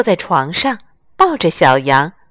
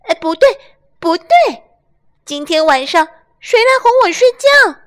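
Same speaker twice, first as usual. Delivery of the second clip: much higher, louder little lamb; 10 semitones higher, 4 dB louder, faster than usual. Acted. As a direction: squeal like a fussy little lamb. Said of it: "雷尼在床单里大呼小叫".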